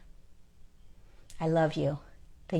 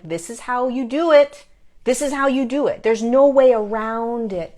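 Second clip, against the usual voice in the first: higher, louder emphatic encouragement; cheering on; 5 semitones higher, 7 dB louder than usual. Retold or imitated. Guides urging humans to keep going, emphatic cheering on. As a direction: drive it home like a rousing coach. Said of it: "It is always a cheering on".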